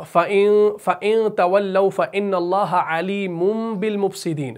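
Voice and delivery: chanted verse with held nasals; Quran recitation. Shown as a demonstration. Teacher cites a verse for learners, chanted.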